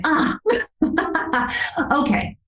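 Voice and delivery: breathy, high awkward chuckle; surprised little laugh before okay. Retold or imitated. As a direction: laugh it off, caught off guard. Caught off guard, told to wait.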